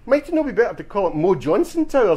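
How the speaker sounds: high-pitched, innocent-sounding proposal; straight-faced suggestion. Acted. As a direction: wind him up with mock-serious politeness. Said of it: "says me with a straight face".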